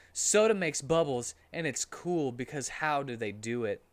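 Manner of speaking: usual speaking voice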